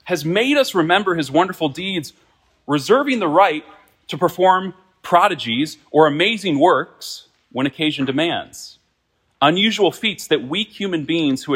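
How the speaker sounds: loud, high preaching from the pulpit; forceful preaching. Acted. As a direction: proclaim it to the whole church. Opening quote preached at full force.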